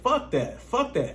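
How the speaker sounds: very high, squeaky fuck that; high-pitched panicked fuck that; panicked refusal. Retold or imitated. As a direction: freak out and refuse.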